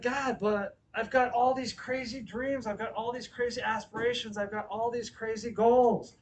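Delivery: high, insistent plea, piling up complaints; frustrated pleading. Retold or imitated. Preacher replays asking God about his crazy goals, exasperated.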